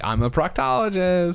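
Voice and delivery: slow, drawn-out mock announcement; comically overplayed job introduction; hammed-up punchline. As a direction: stretch it out for the joke. Delivers punchline as joke character.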